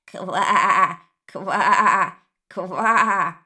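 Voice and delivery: eerie, put-on croaking "Ква"; scary frog croak. Frog scares the rabbit, spooky croaking. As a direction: croak like a monster hiding in the bush. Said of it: "очень страшным голосом".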